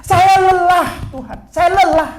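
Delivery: high-pitched wail of an exhausted mother; tearful lament. Acted. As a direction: cry out to God in acted anguish. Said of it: "Dia sambil nangis, dia bilang begini".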